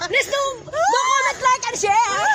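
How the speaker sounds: high, loud call to subscribe; excited. Acted. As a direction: pump up the viewers. Vlogger call to action.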